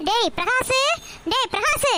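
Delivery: high rising call. Acted. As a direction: call him from another room, pitch climbing at the end. Mother calling her son.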